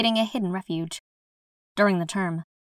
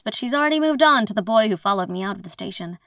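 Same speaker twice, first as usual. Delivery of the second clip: ordinary delivery, nothing marked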